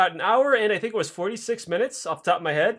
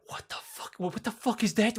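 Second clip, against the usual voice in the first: scared whisper; 3 semitones higher, 8 dB quieter than usual. Retold or imitated. Farmers whispering in fear.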